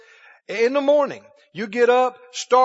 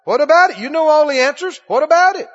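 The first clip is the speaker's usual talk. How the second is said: loud, higher, insistent badgering; pressuring; 6 semitones higher, 7 dB louder, faster than usual. Accusers crowding round Jesus for an answer, insistent pressing. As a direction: keep hammering him with the question. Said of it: "the enemy's pressuring him".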